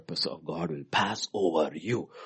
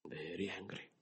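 1 whispered; 13 dB quieter than usual. Acted. Whispered repetition.

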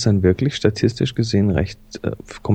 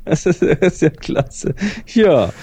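speaking through real laughter, a little slow; words bubbling out between laughs; laughing; 7 semitones higher, slower than usual. Real, not acted. Laughing at the photo.

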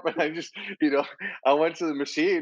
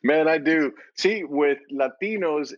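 ordinary delivery

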